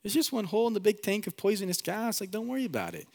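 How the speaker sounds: high breezy brushing-off voice; dismissive reassurer. Retold or imitated. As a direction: wave off the danger like it's nothing. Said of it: "And I go".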